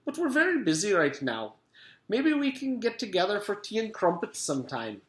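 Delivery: a pleasant Scottish gentleman's call; pleasant stolen voice. A narrator's reading. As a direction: sweetly answer the door. Mister Gorf pleasant.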